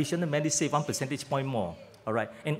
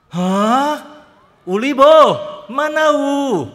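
high, loud, slow alarmed cry; alarmed auntie; 11 semitones higher, 12 dB louder, much slower than usual. Retold or imitated. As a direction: protest like a shocked old auntie. Resident alarmed at premium.

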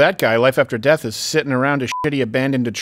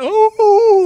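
long, high, loud howl; mock werewolf howl; 7 dB louder than usual. Teasing the storyteller about a werewolf story, mock howl. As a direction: throw your voice up into a drawn-out "oooh".

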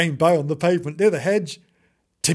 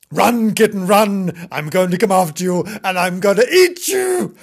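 loud, high, gleeful threat building; menacing dog; 4 semitones higher, 7 dB louder than usual. Acted. Dog threatening to eat the kitten, menacing. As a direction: chase the kitten off with mock menace.

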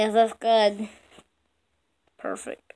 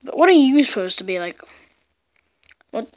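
usual speaking voice

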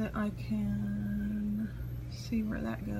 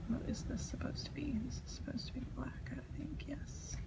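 hushed whisper, household asleep; 4 semitones higher, 8 dB quieter than usual. Real, not acted. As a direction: keep it to a soft whisper. Household asleep, painting quietly.

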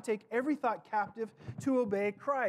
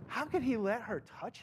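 dismissive scoff, softer; scoffing Pharisees; 5 dB quieter than usual. Retold or imitated. Preacher mimics Pharisees scoffing at the woman. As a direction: sniff at her in contempt. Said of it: "they're like".

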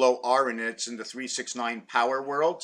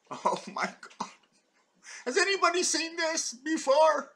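amazed discovery; 13 semitones higher than usual. Real, not acted. Explaining folded paper to the camera, amazed squeal. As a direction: pitch leaps way up in wonder.

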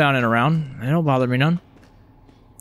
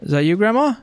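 calling out questioningly; 9 semitones higher than usual. Real, not acted. Searching, calling for grandma.

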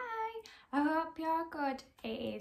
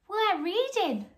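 higher, louder innocent chorus; the veggies answering the evil pea, higher and eager; 6 semitones higher, 7 dB louder, slower than usual. Acted. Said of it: "said the veggies".

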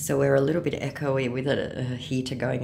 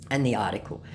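ordinary talk, nothing marked

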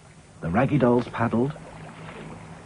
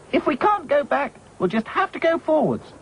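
bravely. A narrator's reading. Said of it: "said Dotty bravely".

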